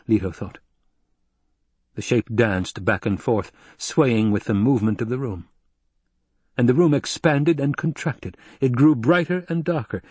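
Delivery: ordinary delivery, nothing marked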